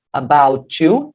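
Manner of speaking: "About you" slowly, joined as "abou-tchu"; linked tch sound. Shown as a demonstration. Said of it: "É esse mesmo som". ("About you" emendado devagar, com som de tchu)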